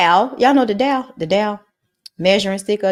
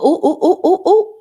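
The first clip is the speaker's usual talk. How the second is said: excited ooh; 8 semitones higher than usual. Real, not acted. Chart shooting up.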